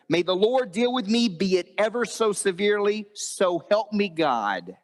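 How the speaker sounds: high, slow, ringing declaration; preacher's fervent retold vow; impassioned oath. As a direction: swear it with mounting weight, drawing out key words. Ruth's oath until death, acted.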